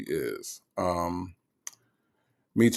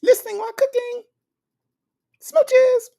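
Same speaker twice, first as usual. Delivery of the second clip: very high, sweet, sing-song greetings and smooches; squeaky-high playful greeting, blowing kisses; V Lenore voice; 4 dB louder than usual. Retold or imitated. Doing a viewer's imagined voice for the chat, very high and sweet. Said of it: "I could do V Lenore, though".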